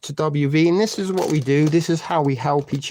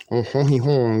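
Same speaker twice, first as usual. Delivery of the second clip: put-on French accent. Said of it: "getting into the French mode".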